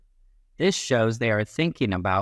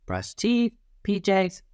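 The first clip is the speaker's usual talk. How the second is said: put-on child listing chores; 8 semitones higher than usual. Child listing bedtime tasks.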